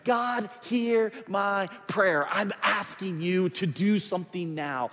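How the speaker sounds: high, loud pleading prayer to God; voicing a fervent prayer. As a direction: cry out to God like Nehemiah. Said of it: "He said".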